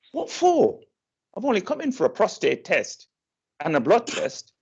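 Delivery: high incredulous protest; mock surprise. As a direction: squeak it out, not believing it.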